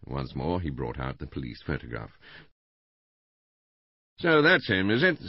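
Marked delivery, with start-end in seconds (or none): 4.2-5.2 high, loud, rambling householder; Mister Bland, chattering. A narrator's reading. Husband gossiping to the inspector about the murder, chattering loudly. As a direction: rattle on excitedly in a raised voice.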